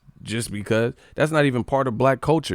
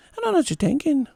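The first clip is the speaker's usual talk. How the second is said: high-pitched mock Irish accent; squeaky-high put-on Irish brogue; Conor McGregor impression; faster than usual. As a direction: imitate an Irish fan copying the fighter, pitched way up. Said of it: "Talking like him".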